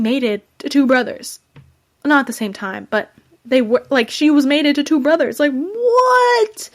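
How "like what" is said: a sudden, much higher "what?"; shocked disbelief. Real, not acted. Reviewer exclaiming to viewers over the two brothers twist, shrill shocked "what?". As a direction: shoot way up in shock.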